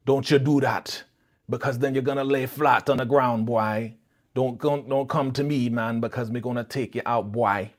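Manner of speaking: mock-threatening Jamaican patois; terrible Jamaican accent. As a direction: warn him off in a bad fake Jamaican accent. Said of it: "his accent was terrible".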